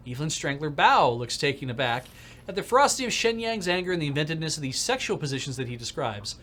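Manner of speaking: normal talking voice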